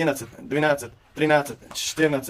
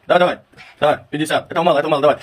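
loud urging; 2 semitones higher, 7 dB louder, much faster than usual. Real, not acted. Coach urging son through push-ups.

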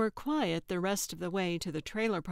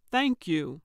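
louder, pointed "Thank you"; pointed demand; 7 dB louder than usual. A narrator's reading. Calvin telling Gertrude what she should say, pointed. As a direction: land it as the thanks owed.

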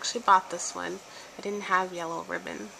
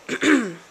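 throat clearing; 3 semitones higher, 9 dB louder than usual. Real, not acted. Sick, clearing throat mid-sentence.

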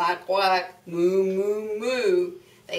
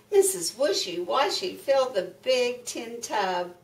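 ordinary delivery, nothing marked

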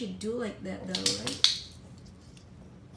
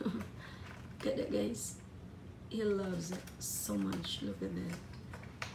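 soft, slow whispered aside; hushed whisper; 5 semitones lower, 11 dB quieter, slower than usual. Real, not acted. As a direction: whisper so he doesn't notice. Whispering about the pet.

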